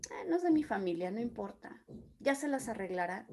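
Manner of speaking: soft, quick, dismissive mumbled brush-off; mock indifference. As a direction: act out not caring, tossed off quickly and quietly. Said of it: "podemos ser tan indolentes". (indiferencia fingida, en voz baja y rápida)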